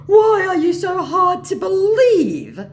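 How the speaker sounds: high, loud, slow mock lament; lamenting scammer. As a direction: wail it out as the wronged scammer. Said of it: "laments my scammer".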